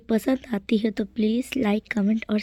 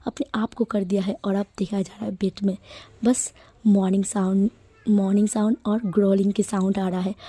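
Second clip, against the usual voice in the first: usual speaking voice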